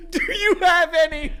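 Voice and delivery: high voice squeezed by laughter; real giggles breaking up the words; laughing while talking. Real, not acted. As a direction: barely get it out for laughing. Cracking up while telling story.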